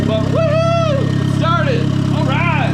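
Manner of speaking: high, loud whooping celebration; excited cheering. Real, not acted. Mechanic celebrating that it started, excited cheering. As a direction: cheer loudly over a running engine.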